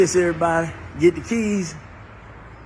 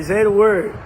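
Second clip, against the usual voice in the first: urgent plea; 6 semitones higher, 9 dB louder than usual. Retold or imitated. Urging a relapsing woman home.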